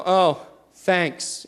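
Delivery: put-on flat, grudging "thanks" of a sulky kid; sarcastic thanks. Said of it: "just like that".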